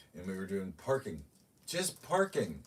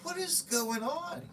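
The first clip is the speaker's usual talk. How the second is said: baffled; slower than usual. Retold or imitated. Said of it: "I'm like".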